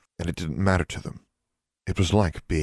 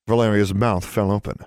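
ordinary delivery

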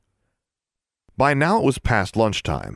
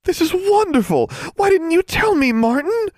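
ecstatic gushing; 4 dB louder than usual. Acted. Darla gushing loudly.

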